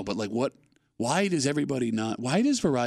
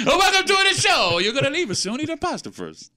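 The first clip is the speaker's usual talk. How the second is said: restaurant host voice; 10 semitones higher, 4 dB louder than usual. Pretend. Mimicking restaurant host welcoming diners.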